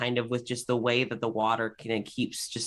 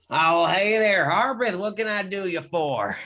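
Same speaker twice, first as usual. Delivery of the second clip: lightly voiced easygoing mechanic, a bit higher and louder; friendly mechanic Rob; 7 semitones higher, 5 dB louder than usual. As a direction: greet the newcomer like a laid-back garage owner. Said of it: "leans forward and is like".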